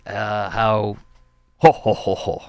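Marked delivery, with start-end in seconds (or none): none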